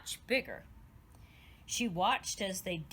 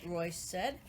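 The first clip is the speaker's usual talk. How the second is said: ordinary delivery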